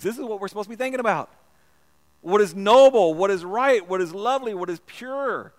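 loud, ringing scripture reading; full-force preaching. Acted. Preacher reading Philippians aloud to the congregation, loud and ringing. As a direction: proclaim each line to the back row.

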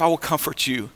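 low, soft, reassuring voice; gentle comfort. Acted. Jesus comforting the one in the valley, soft and low. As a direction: let it drop to a tender hush.

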